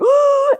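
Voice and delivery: high, loud frightened gasp; scared gasp. Acted. Showing a puppet how to be scared, frightened gasp. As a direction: gasp and hide from the monster. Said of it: "if you were scared".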